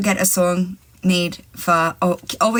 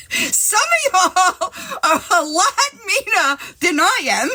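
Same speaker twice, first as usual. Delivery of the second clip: laughing; 12 semitones higher than usual. Real, not acted. Laughing at mean chat comments.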